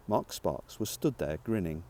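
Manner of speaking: usual speaking voice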